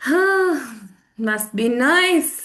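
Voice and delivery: high huffed "huh", drawn-out envy; jealous. Shown as a demonstration. Friend hearing about a trip to Wadi Rum, jealous. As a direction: drag it out with a jealous edge. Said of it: "You're being jealous, okay?".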